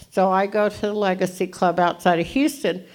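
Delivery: usual speaking voice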